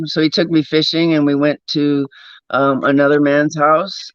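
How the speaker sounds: ordinary talk, nothing marked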